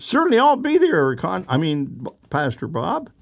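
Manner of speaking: high, gushing, then slipping back down; flustered young lady. Retold or imitated. Young lady promises the pastor to come Sunday. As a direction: promise eagerly, then stumble over the name. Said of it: "She said".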